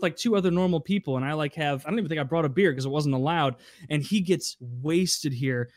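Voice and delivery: usual speaking voice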